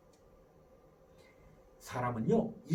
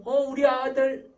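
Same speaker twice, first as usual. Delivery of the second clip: put-on high, loud gushing over a child; fond, effusive parental greeting; affectionate mother; 4 semitones higher, 9 dB louder, slower than usual. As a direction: act a doting mom calling her son over. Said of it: "하고 불러요".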